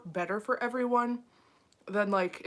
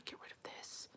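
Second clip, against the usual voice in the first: whispering; 21 dB quieter than usual. Real, not acted. Muttering at tech problem.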